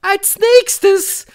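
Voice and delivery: very high, loud witch voice; piercing high proclamation; commanding Grand High Witch. A narrator's reading. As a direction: shout it as a domineering witch leader.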